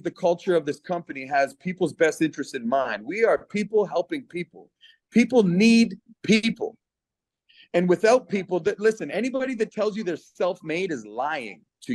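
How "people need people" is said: slow, louder and higher, punching every word; emphatic slogan. Real, not acted. To the Zoom group, driving his slogan home, slow and emphatic. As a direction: hammer home the three words, slowly.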